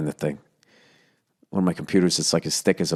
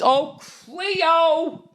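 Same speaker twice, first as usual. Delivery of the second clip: high, drawn-out call; long high-pitched holler for a pet; yelling dog's name. Shown as a demonstration. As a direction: sing out the dog's name as if out a window. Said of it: "yell her name out the window".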